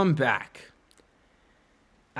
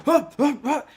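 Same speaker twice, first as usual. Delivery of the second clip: much higher mock startled groans; 11 semitones higher than usual. Friend jolting awake in his car, mock startled. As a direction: ham up waking with a jolt. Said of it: "He's like".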